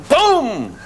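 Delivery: high, drawn-out boom; sudden explosive boom falling away; sonic boom imitation. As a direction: burst out with a long booming noise.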